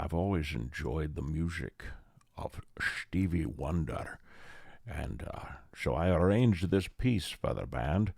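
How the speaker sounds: mock Scottish brogue with slurred S's; Sean Connery. Actor introducing his own jazz arrangement, in a Scottish accent. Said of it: "to use that voice".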